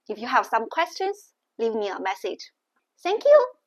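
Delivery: usual speaking voice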